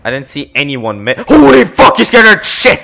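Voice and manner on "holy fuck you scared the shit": high, jumpy scared outburst; startled exclamation. Real, not acted. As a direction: yelp it like you just got spooked. Startled by enemy appearing.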